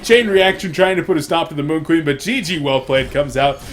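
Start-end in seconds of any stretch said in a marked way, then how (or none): 0.0-1.6 loud, high, excited casting; hyped shouting play-by-play. Acted. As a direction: shout the big moment live. Live play-by-play climax.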